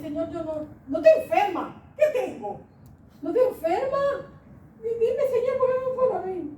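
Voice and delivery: high pleading with a laugh in it; retold anxious prayer. (súplica ansiosa, aguda, entre risas)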